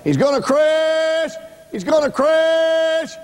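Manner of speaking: high, drawn-out wail of alarm; high-pitched sustained holler; screaming and hollering. Retold or imitated. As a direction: re-enact her scream, stretching the last word long. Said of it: "she began screaming and hollering".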